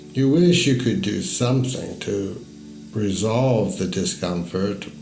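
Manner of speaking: usual speaking voice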